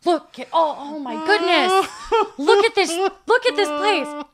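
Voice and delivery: high, loud, hammed-up wonder; gushing awe. Oblivious tourist gawking through a wedding photo shoot, gushing. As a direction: gush in mock awe, slowing on the exclamation.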